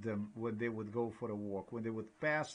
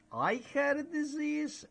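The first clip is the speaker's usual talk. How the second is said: high, incredulous jab at the neighbor; mock incredulous retort; 13 semitones higher than usual. Retorting to a neighbor, in a high incredulous voice. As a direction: squeak it up in disbelief.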